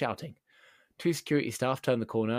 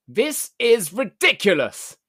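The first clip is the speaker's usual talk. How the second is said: slow, drawn-out shout, much higher and louder; building shout; 9 semitones higher, 11 dB louder, much slower than usual. Retold or imitated. Furious customer losing patience in the shop, slow building shout. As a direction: build up to a full yell on every word. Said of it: "she built up to a shout".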